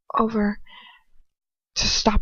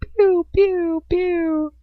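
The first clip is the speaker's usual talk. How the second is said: mock laser pew; 12 semitones higher, 3 dB louder, much slower than usual. Imitated blaster sound.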